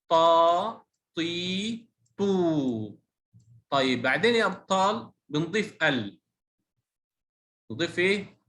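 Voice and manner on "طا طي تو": طا، طي، تو each held long; long vowels. Shown as a demonstration. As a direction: stretch the vowel of طا، طي، تو. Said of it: "طيب الصوت الطويل".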